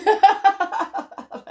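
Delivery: a bright squeal of laughter; high loud laugh. Real, not acted. Vlogger after voicing the little fish, laughing loudly. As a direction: burst out laughing at your own bit.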